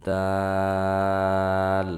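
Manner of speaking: দাল stretched very long and held level; long drawn-out letter. Shown as a demonstration. Teacher drawing out দাল for learners to copy. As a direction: sustain দাল like a chanted recitation. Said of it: "আমার সাথে সাথে পড়বেন".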